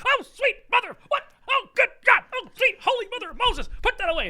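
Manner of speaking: very high, loud, squeaky horrified exclamations; imitating an actor losing his composure in a shriek; flustered shocked outburst. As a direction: squeal in horror at the ugly painting. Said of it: "Peter MacNicol loses it and just like".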